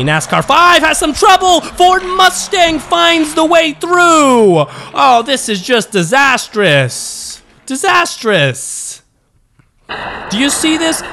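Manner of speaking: high, emphatic commentator outcry, stretched on key words; live race play-by-play. Acted. Commentator scolding lazy racing after the jump, high excited play-by-play. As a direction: call it like an exasperated commentator, pitched right up.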